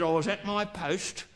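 wife's weary voice. Retold or imitated. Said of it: "she said".

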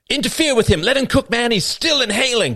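high, loud frantic mock warning; mock urgent protest. Bystander guarding the still-inhaling sportscaster, mock urgent.

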